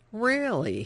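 officer stretches Really? to doubt her; drawn-out question. A narrator's reading. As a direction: drag the word out into a doubting question. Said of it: "drawing length from the word, making it a question".

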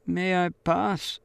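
put-on a frightened girl asking again; timid plea. A narrator's reading.

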